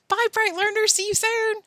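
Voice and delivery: bright, high, sing-song farewell; cheery goodbye. Real, not acted. Teacher cheerfully says goodbye to her young learners, high and bright. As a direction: send the class off beaming.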